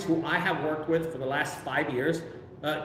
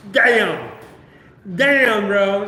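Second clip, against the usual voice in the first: louder damn, damn, bro, punched out; loud disbelief; 3 semitones higher, 9 dB louder, much slower than usual. Real, not acted. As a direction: blurt out the shock at the money. Reacting to hearing the overpayment.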